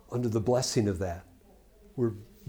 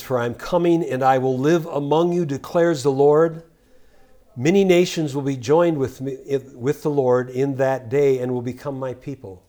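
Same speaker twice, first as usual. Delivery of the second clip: loud, ringing scripture proclamation; scripture reading; 4 dB louder than usual. Acted. Preacher proclaiming the prophet's words loudly. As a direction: declaim the verse to the congregation. Preacher rereads verse ten.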